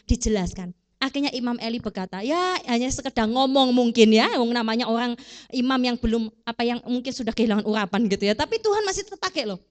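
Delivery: ordinary delivery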